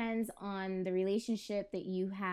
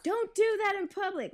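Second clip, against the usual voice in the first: imagined shout; 12 semitones higher, 6 dB louder than usual. Retold or imitated. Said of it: "not like I'm gonna shout".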